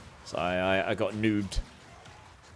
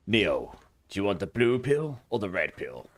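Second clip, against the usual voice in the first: Morpheus quote; 4 semitones lower than usual. Retold or imitated. Streamer quoting Morpheus to viewers, mock solemn. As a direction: offer the pills like Morpheus, gravely. Matrix quote while picking beans.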